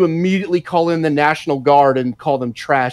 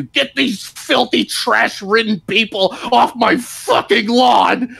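slow, higher hammed-up yell; mock angry shout; 6 semitones higher, slower than usual. Mocking Democrats turning on migrants, a hammed-up slow angry yell. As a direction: bark it out like a furious homeowner. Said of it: "they went from that to".

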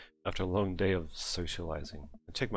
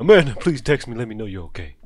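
loud pleading; 3 semitones higher, 13 dB louder than usual. Acted. Dad desperately texting missing daughter.

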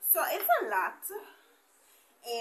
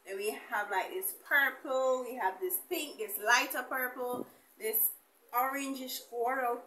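ordinary delivery

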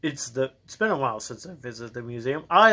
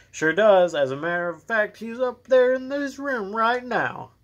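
a hint of a guard, pitched up; lightly voiced helpful security guard; 8 semitones higher, 3 dB louder than usual. Guard telling police where the doctor is, lightly acted and helpful. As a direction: lightly act a guard, rising high and slowing at the end.

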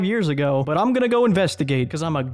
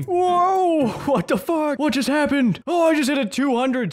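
much higher, astonished exclamations; surprised; 10 semitones higher than usual. Real, not acted. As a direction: genuinely caught off guard by the big number. Big hit surprises him.

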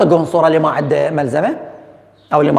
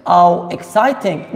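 slow modelling; much slower than usual. Shown as a demonstration. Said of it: "teacher drawls the choice".

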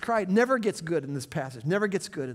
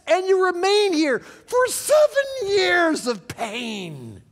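high, loud, shouted sermon climax; impassioned preaching, much higher and louder; fiery preaching; 12 semitones higher, 8 dB louder, slower than usual. Acted. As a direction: drive it home to the congregation. Preaching at full force.

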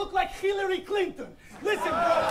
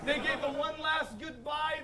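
ordinary talk, nothing marked

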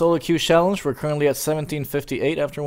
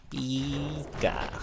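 put-on Russian accent; 12 dB quieter than usual. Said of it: "That was real Russian accent right there".

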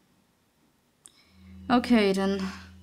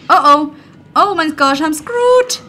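higher and louder, rising panic; panicked; 5 semitones higher, 7 dB louder than usual. Real, not acted. Gamer panicking as the blind monster comes for him.

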